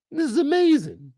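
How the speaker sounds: excited praise. Real, not acted. Praising the show, second time high and excited. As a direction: burst out "This is amazing" with excitement.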